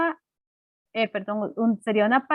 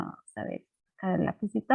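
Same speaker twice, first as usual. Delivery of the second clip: muttered aside; 3 semitones lower, 9 dB quieter, faster than usual. Real, not acted. Teacher muttering while finding pencil.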